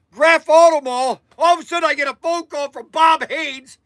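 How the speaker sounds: ordinary talk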